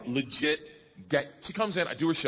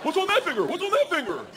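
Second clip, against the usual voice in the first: high, urgent repeated question; hammed-up high-pitched exclamation; acted-out alarm; 9 semitones higher than usual. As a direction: demand it in mock panic. Repeats the shouted trick line.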